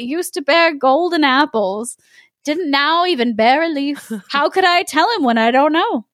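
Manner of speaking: put-on high, innocent old woman; sassy grandmother. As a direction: play the frightened granny, lightly. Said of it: "said she".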